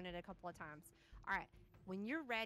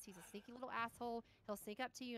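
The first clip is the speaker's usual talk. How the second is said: usual speaking voice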